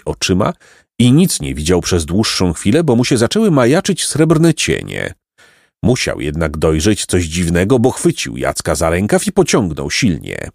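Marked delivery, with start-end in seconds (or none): none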